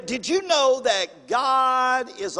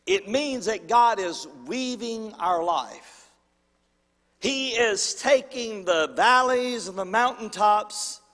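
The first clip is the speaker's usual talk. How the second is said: ordinary talk, nothing marked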